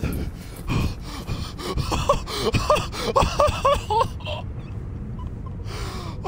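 shrieking laugh of relief; high relieved laugh. Real, not acted. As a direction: laugh out the panic.

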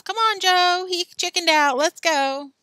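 loud, high-pitched taunt; impatient calling out. Acted. Alan tells Joe Billy chickened out, loud impatient call. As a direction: call your friend away, loud and fed up.

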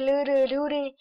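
ordinary delivery

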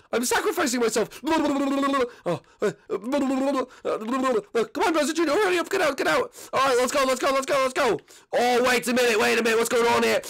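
high, frantic escape voice; high, urgent and panicking during the jailbreak; panicked prisoner. Acted. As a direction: scramble to get everyone out.

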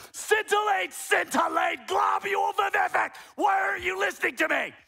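full high-pitched mock-exasperated shout. Shown as a demonstration. Preacher demonstrating that volume doesn't help, emphatic shouting. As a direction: yell every word as if nobody is listening. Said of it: "Would me saying it louder and more, more, more".

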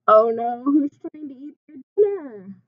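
ordinary talk, nothing marked

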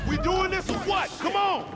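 loud, high shout over gunfire. Acted. Gangster shouting to his crew mid-gunfight. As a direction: yell at the crew to get moving.

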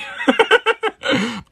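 loud high laugh; burst of laughter. Real, not acted. Laughing loudly at a viewer's marriage proposal.

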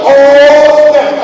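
high, loud stunned exclamation; shocked. Retold or imitated. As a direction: gasp it out, caught off guard. Shocked reaction.

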